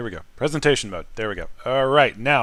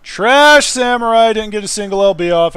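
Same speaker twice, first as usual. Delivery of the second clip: ordinary talk, nothing marked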